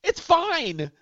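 high, incredulous scoff; scoffing at Boston's only famous beer, pitched high. Acted. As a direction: sneer at their claim in a high voice. Mocking Boston's beer pride.

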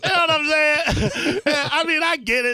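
laughing through the joke; chuckling while teasing mom. Pretend. Joking about mom's bricks.

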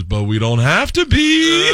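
high, drawn-out protest; dim daughter. Acted. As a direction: play a clueless daughter, stretching the words. Dim daughter's punchline reply to dad.

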